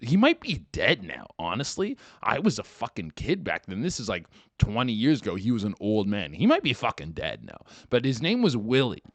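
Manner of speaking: ordinary delivery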